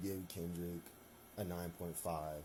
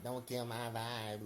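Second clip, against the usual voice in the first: nerd voice; 3 semitones higher than usual. Retold or imitated. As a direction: do a goofy nerd voice. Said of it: "a kind of nerd voice".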